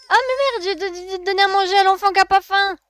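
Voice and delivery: loud, high exasperated yell; high-pitched shout at a silly mistake; alarmed outburst. Real, not acted. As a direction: blurt it out loud in panic. Alarm at feeding wrong baby.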